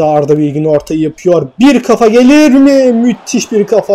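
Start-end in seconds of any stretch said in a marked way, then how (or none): 1.6-3.9 excited commentary. Real, not acted. Commentator willing a header in, excited. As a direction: call the chance as it happens.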